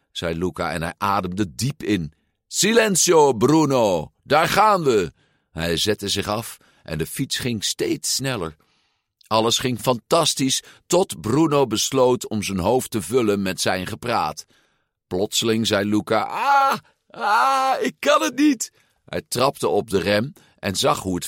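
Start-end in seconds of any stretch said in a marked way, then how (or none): 2.5-5.1 high, loud battle cry; psyching up. A narrator's reading. Psyching himself up to ride. 16.2-18.7 very high panicky wail; panicked. A narrator's reading. Panicking while racing downhill.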